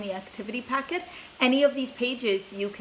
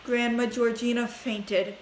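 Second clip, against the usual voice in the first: ordinary delivery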